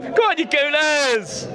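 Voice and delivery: high, drawn-out football chant call; rallying fan cheer. Real, not acted. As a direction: rouse the fellow fans. Rallies fellow Arsenal fans.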